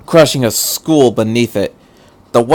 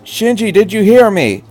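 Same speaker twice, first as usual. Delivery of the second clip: high pressing question; urgent call; 10 semitones higher, 3 dB louder than usual. Acted. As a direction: demand an answer over the radio. Misato calls again.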